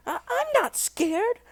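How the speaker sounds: put-on high, fast, frightened little boy voice; scared boy. Boy answers bullies.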